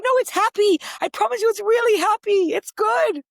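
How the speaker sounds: very high, loud, pleading insistence; defensive protest. Retold or imitated. Mum defending her podcast to nine-year-old, high protest. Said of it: "trying to defend myself".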